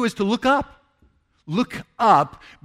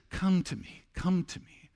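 gentle invitation; 8 dB quieter than usual. Pretend. God's gentle invitation voiced.

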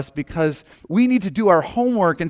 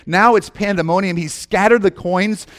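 ordinary delivery

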